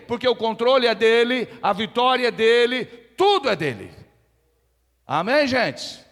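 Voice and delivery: loud, high, building sermon climax; full-force preaching. Real, not acted. Preacher declaring God's will to the congregation, full-force preaching. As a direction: hammer each phrase home with rising force.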